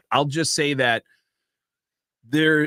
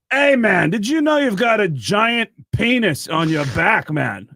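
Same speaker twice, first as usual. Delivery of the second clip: loud, high-pitched rock singer impression; 7 semitones higher, 5 dB louder than usual. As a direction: call it out heartily across a dressing room. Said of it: "[name] ... walks over and goes".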